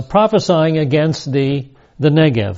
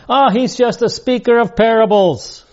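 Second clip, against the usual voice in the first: high, sneering voice of gossiping exiles; mocking gossip; 8 semitones higher than usual. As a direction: scoff at the prophet in a higher, put-on voice. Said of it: "Ezekiel is being mocked".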